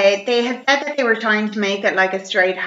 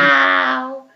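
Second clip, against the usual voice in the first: mock wow; 4 semitones higher than usual. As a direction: gasp it out in mock wonder. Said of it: "And you're like".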